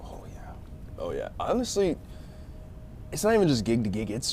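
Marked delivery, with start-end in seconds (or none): 0.0-0.5 whispered. Real, not acted. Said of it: "whispered then plain".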